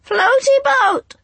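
very high, loud, slow squawks; clanging bird chorus. A narrator's reading. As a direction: clang it like rusty bells. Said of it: "cried the angels".

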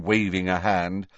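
ordinary delivery

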